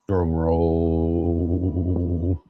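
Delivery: low, held-out monotone; suspenseful announcement. Pretend. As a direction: draw the words out low to build suspense. Host announcing a prize draw.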